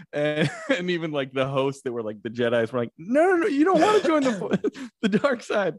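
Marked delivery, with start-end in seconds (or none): 3.0-5.8 very high, fast panicky host voice; squeaky frantic no no no; panicked host. Retold or imitated. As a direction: rush to stop the kid, laughing. Jedi hosts panic in story.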